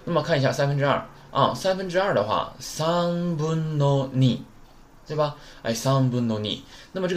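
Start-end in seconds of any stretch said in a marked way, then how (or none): 2.6-4.4 very slow, higher-pitched model reading; held syllables, teacher demonstrating the phrase; drawn out. Shown as a demonstration. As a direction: stretch each syllable of 三分の二 out long.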